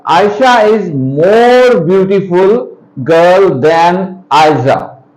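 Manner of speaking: slow, spaced-out reading for learners; deliberately measured classroom read-aloud; slow example reading. Shown as a demonstration. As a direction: read out slowly, word by word. Reads written example to students.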